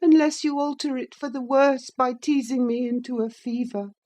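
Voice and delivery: put-on high, peevish boy reproach; high sickly boy being pettish. A narrator's reading. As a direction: sulk it at her. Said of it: "he replied pettishly".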